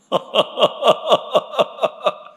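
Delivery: bright falling laugh of joy; a happy burst of laughter; delighted laugh. Real, not acted. Laughs at beef rice lunch.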